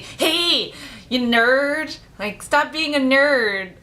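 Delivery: high, loud mock jeer; imitated bully yelling "nerd" at a kid; bully taunting. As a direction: sneer it out like a tall playground bully. School bully shoving and taunting.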